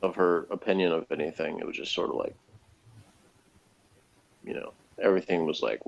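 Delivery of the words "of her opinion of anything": ordinary talk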